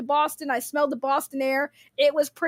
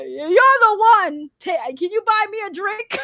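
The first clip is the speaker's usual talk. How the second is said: mock flirty; 6 semitones higher, 4 dB louder than usual. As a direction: ham up a flirty woman at the bar.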